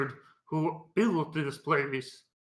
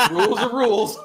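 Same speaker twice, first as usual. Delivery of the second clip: laughing; 5 semitones higher, 7 dB louder, faster than usual. Real, not acted. Laughing through the joke.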